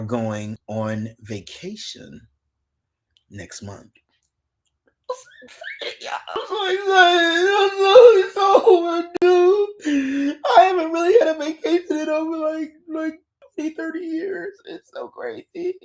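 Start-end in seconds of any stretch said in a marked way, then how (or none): 5.1-15.7 high, squealing mock-crying excitement; mock excited squeal. Host squeals to viewers about her vacation, mock tearful. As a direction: squeal like you could cry with joy.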